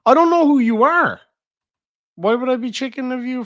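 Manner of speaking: high, loud exasperated outburst; loud protest. Real, not acted. Streamer loudly protesting to an unknown viewer in chat. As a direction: throw it back at the stranger, loud and high.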